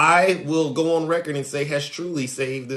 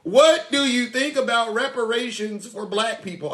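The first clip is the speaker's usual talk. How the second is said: mock follower voice; 7 semitones higher than usual. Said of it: "this is what they say".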